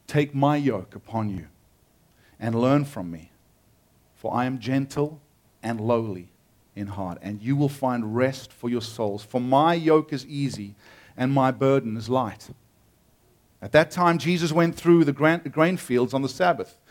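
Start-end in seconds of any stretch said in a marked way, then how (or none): 0.1-12.0 measured, lowered reading voice; scripture reading. Acted. Preacher reading Matthew to the congregation, reverent. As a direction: read the passage with reverence. Reads scripture passage aloud.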